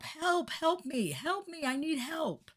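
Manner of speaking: high, drawn-out slow cry for help; hammed-up high plea for help; screaming for help. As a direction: wail it high and slow like someone drowning. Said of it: "they're screaming for help".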